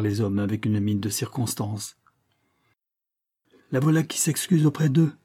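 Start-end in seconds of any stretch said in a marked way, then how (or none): 3.7-5.1 higher-pitched, discontented inner voice; mildly indignant husband; irritated. A narrator's reading. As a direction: think it with sour annoyance. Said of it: "pensa avec mécontentement son mari irrité".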